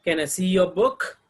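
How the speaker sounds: "Can I see your book" loud, rising to "book"; yes-no question rise. Shown as a demonstration. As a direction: ask it, lifting "book" at the end.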